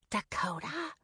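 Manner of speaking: almost silent mouthed word. A narrator's reading. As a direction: barely voice it, just mouthing. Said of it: "Molly mouthed".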